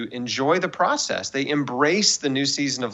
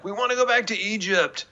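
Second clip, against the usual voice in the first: high, mock-whining complaint; whiny Israelites; 8 semitones higher than usual. Israelites in the wilderness grumble to go back, mock whine. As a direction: whine it like grumbling wanderers in the desert. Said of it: "They were like".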